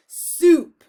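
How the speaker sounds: very loud, high defiant shout. Acted. Cook shouting the forbidden word in defiance, loud. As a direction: hurl the word out at full volume. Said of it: "shouted Cook".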